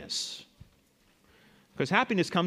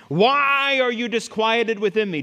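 high, loud question, stretched out slowly; psalmist challenging himself; 7 semitones higher, 6 dB louder than usual. Acted. Psalmist asks his troubled soul, loud challenge. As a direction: demand an answer from your own soul. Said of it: "He talks to himself".

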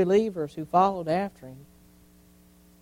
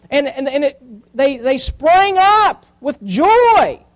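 forceful preaching; 7 semitones higher, 8 dB louder than usual. Real, not acted. Preacher retelling the sower parable, forceful and building. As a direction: preach it up to a big finish.

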